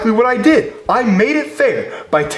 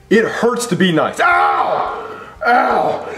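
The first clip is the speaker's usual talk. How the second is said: hammed-up cries of pain; mock pained shouts; much slower than usual. [name] hamming up pain over being nice, mock yelps. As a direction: yelp as if heart breaks.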